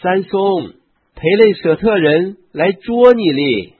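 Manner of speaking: high, loud urgent call. A narrator's reading. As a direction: cry out a warning to wake someone. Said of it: "向他喊说". (高声急喊)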